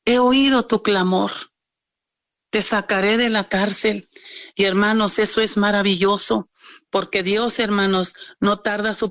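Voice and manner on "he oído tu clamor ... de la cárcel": a hint of a solemn heavenly voice; lightly voiced voice of God, measured; powerful voice from heaven. Preacher retells heaven's answer to the prisoner, lightly voiced as powerful. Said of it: "llega esa voz poderosa del cielo".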